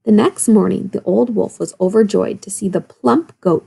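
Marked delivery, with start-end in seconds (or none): none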